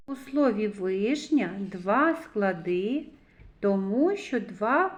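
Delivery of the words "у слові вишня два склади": ordinary talk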